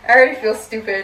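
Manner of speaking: embarrassed laugh through words. Real, not acted. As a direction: giggle as you admit it. Laughing at herself.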